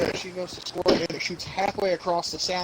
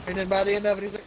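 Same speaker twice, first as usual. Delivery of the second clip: ordinary delivery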